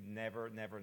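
ordinary delivery

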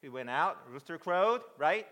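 usual speaking voice

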